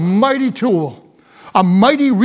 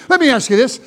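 ordinary talk, nothing marked

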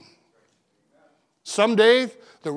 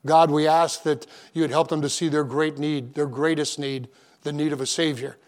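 pastoral prayer; 3 semitones lower than usual. Acted. Opening prayer before the sermon.

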